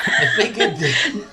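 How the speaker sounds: slow, laughing words; breaking into laughter. Real, not acted. Cracking up at Greco-Roman.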